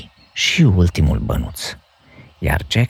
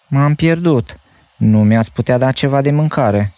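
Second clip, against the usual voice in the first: stammering; 8 semitones higher, 4 dB louder than usual. A narrator's reading. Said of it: "abia izbuti să îngaime".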